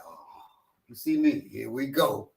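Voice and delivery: low, soft mock resignation; disappointed. Apple seeing the driver pull up, mock disappointed. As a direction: imitate a weary letdown, dropping the voice.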